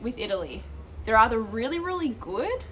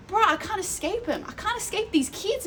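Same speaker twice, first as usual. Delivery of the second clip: high-pitched, hammed-up exasperation; mock despair; 10 semitones higher than usual. Woman teased by kids everywhere, high mock-despairing complaint. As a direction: wail it as a comic complaint.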